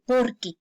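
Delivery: PORQUE as one unbroken word; "porque" glued into a single piece; joined word. Shown as a demonstration. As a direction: say "porque" with no gap. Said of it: "o sea, suena unido".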